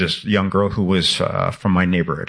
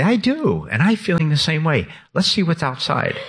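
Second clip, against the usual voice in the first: higher-pitched, lively answer in an imagined exchange; eager reply; 8 semitones higher than usual. Acted. Author answering an imagined George in his story, eager reply. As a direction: answer back high and keen, lightly acted. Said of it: "And I say".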